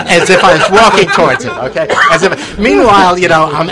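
loud, laughing retelling; excited laugh at his own scheme. Real, not acted. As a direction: burst out laughing while repeating it. Retelling the staged approach, laughing.

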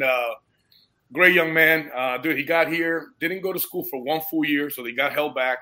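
usual speaking voice

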